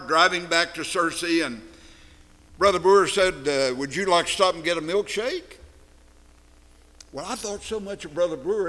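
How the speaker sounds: ordinary talk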